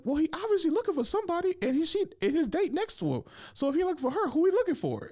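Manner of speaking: very high, squeaky retort; imitated arguing guy. Guy arguing with his girl in the bathroom, high-pitched mock protest. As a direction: act out his protest in a falsetto. Guy argues back, acted out.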